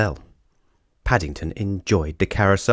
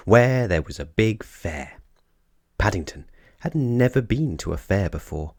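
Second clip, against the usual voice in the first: usual speaking voice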